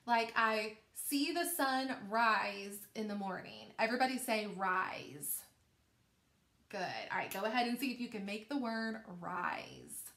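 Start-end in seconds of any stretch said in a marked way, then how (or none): none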